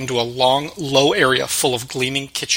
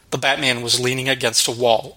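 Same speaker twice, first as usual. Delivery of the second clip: ordinary delivery, nothing marked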